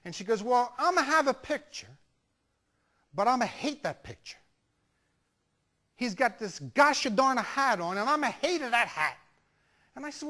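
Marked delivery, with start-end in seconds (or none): 0.4-9.2 grumbling Italian grandma, words punched; Italian grandmother accent. Retold or imitated. Grandmother admits the hated picture to grandson, grumbling Italian accent. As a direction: put on thick Italian-accented English, disgusted with the hat. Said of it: "nice little old Italian lady".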